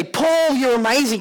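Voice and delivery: high, gushing praise; mock adoring fans; admiring crowd. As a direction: fawn over Paul. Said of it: "When people come up and say".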